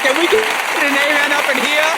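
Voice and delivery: high, loud church call for an amen; mock preacher calling for an amen. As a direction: rouse the congregation.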